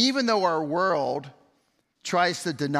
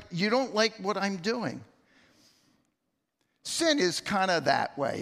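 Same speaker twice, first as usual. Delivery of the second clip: ordinary delivery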